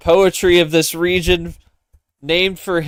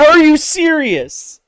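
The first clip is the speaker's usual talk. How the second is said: incredulous; 11 semitones higher, 6 dB louder, slower than usual. Real, not acted. Disbelief at all wilds used.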